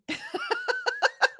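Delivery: high laugh. Real, not acted. Laughing at her cleaning pride.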